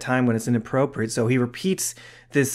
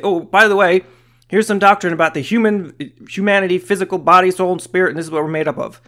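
higher, louder imitation of an interjection; mock announcement; 5 semitones higher, 6 dB louder than usual. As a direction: toss it in loudly as a mock sudden afterthought. Imagined offhand doctrinal aside by Paul.

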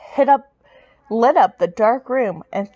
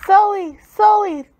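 high, loud urgent calls; shouting; 8 semitones higher, 4 dB louder than usual. A narrator's reading. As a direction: call out in alarm across the floor. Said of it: "manager shouted".

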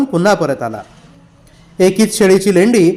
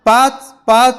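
a touch of strain, higher, as if bent under a load; straining under weight; 5 semitones higher, slower than usual. A narrator's reading. Boy bent under Fakira's weight answers with strain. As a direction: squeeze the words out under a rider's weight. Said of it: "तिरपडत फकीराचा भार आवरत म्हणाला".

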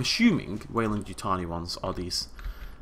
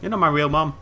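mock defiant; 6 semitones higher, 8 dB louder than usual. Joking defiance at game prompt.

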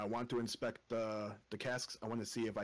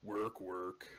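orc peon voice; 4 semitones lower than usual. Retold or imitated. Warcraft peon quote.

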